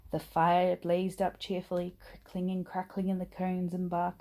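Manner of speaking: usual speaking voice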